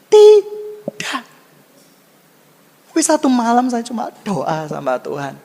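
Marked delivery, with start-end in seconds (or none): 0.1-1.2 loud, high, emphatic no; tidak yang tegas. Real, not acted. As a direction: cry out a drawn-out denial. Jawaban tegas atas pertanyaannya sendiri. (seruan tegas bernada tinggi)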